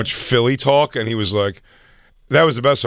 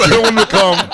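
laughing; 8 semitones higher, 4 dB louder than usual. Real, not acted. Joking dread with laugh.

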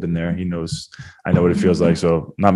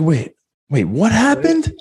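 higher, lost, like a broken record; dazed repeat; 13 semitones higher, slower than usual. Retold or imitated. Friend mimicking the concussed fighter repeating himself, high dazed question. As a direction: ask it again as if for the first time. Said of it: "He's like".

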